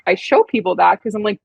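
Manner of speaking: ordinary talk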